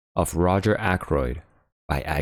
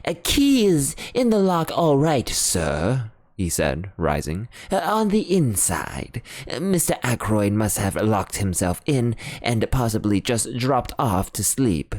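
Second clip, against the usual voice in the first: higher, deferential butler voice; butler Parker; 4 semitones higher than usual. Acted. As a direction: report what he sees through the keyhole. Parker at keyhole.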